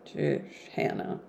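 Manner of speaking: soft whispered, exasperated aside. Real, not acted. Reader reacting to Hannah in the book, whispered exasperation. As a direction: shake your head at the servant under your breath.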